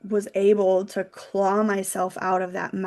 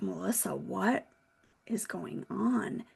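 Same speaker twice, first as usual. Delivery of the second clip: much softer, hushed and gentle; concerned colleague; 11 dB quieter than usual. Retold or imitated. Colleague gently asking why she had been crying, hushed. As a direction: ask quietly, worried for her. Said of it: "she's like".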